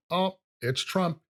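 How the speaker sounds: normal talking voice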